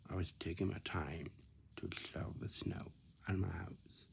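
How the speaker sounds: ordinary delivery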